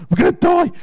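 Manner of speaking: very high, loud mock panic; panicked cry. Teller seeing police with guns, panicked shriek. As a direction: shriek it in hammed-up terror.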